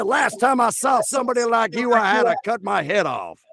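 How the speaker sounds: high, stuttering Southern blowhard; cartoon rooster bluster, falling away at the end; Foghorn Leghorn. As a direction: stammer into a high folksy boast. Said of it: "sound a little bit like Foghorn".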